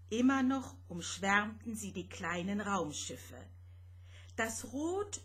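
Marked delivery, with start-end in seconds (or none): none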